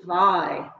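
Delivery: waai with a v-like W, pitched low; Afrikaans W. Shown as a demonstration. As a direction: demonstrate waai, its W sounded as v. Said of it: "sounds different in Afrikaans".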